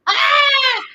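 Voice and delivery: a loud, high laughing shriek; real laughing yelp; laughing cry. Real, not acted. Paint everywhere.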